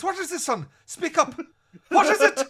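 put-on very high, loud squawking old man; old man shouting. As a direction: demand an answer, squeaky and bossy. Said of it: "He goes, he goes".

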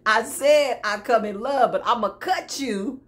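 hammed-up warning, punched out loud; mock threat. Acts out the fed-up believer's threat.

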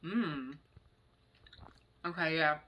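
speaking between bites; chewing while talking. Real, not acted. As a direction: mouth partly full. Seen 2: Eats snacks, chewing between lines, hand to mouth repeatedly.

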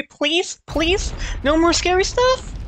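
high, whiny begging; pleading. Real, not acted. Begging the game to stop.

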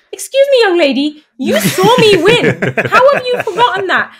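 high, hammed-up telling-off with a laugh; mock stern mum. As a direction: play a mock-outraged mum scolding her girl. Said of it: "I was a little bit annoyed".

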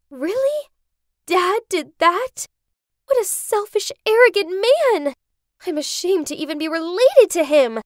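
high, loud outrage; indignant. A narrator's reading. Tracy learns what Dad did, indignant. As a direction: rage at his behaviour, punching the key words.

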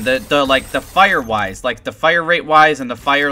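normal talking voice

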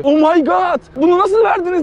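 pitched high, hamming up shock; mock amazed gasp. Host imitating the contestant's stunned reaction, mock surprise. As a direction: play it like the prize is unbelievable. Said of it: "Hani böyle diyeceksin ki".

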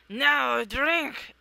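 loud imperious command; commanding shout. Acted. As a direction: bark the order from a throne. Dream being commands.

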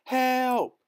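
high, loud shout for help. A narrator's reading. Hoisted youth shouts for help, high and loud. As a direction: scream for help. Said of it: "he shouted".

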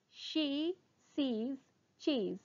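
separated words, drawn out; slow tongue twister. Shown as a demonstration. Teacher repeats the twister for students, still slowly. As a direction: leave gaps between the words. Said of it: "first try saying them slowly".

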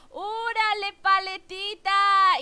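loud, slow, drawn-out cheer; excited call-out. Real, not acted. Streamer cheers a viewer's avatar, loud and drawn out. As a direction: stretch out the name in a cheer.

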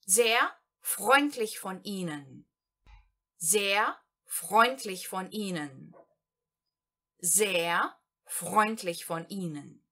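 Sehr freundlich von Ihnen, slowly with Sehr leading; slow German phrase. Shown as a demonstration. Teacher models a polite thank-you phrase for learners, slowly. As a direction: repeat the polite phrase slowly three times. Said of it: "o exemplo número três".

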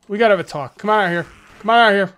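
taunting call out. Acted. Calling zombies out to fight.

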